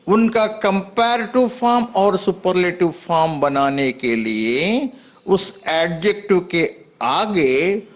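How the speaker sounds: normal talking voice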